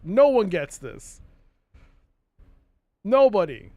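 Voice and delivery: loud emphatic insistence. Real, not acted. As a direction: insist hard. Emphatic rant.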